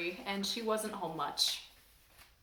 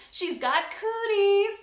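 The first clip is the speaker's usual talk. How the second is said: higher, louder, slow accusation; slow, loud tattling; accusing; 8 semitones higher, 7 dB louder, slower than usual. Acted. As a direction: tattle on her loudly. Child accusing classmate to principal.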